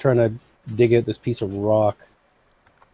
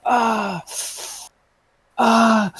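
mock pained cry; 10 semitones higher than usual. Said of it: "does the".

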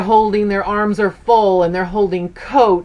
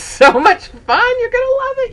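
much higher, bright promise; excited. Real, not acted. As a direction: sell it to the viewers with a high lift. Urging viewers to go-kart.

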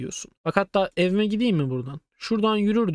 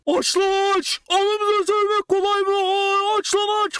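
retold screaming; 10 semitones higher, slower than usual. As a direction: shriek at the door to open up. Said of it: "bu çığlık bağırıyoruz".

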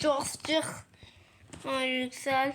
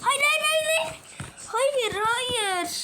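much higher, frantic repeated no; panicked squeal; 10 semitones higher, slower than usual. Real, not acted. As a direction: squeal no as everything goes wrong. Panic in game.

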